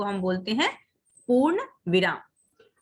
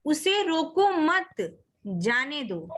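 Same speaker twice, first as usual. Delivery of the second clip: उसे रोको मत, long break, जाने दो; pause after मत; 3 semitones higher, much slower than usual. Shown as a demonstration. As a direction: slowly join मत to रोको, then halt. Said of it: "मत के बाद कॉमा है".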